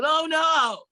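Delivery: loud groaning "oh no"; retold yell. Old teacher yelling at a student he dreads. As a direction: yell it out in dismay. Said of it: "He'd yell".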